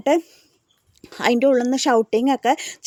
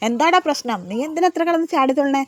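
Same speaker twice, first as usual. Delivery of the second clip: higher, louder mock scolding; a mother's raised rebuke, retold; 4 semitones higher, 4 dB louder than usual. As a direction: scold a hot-headed son.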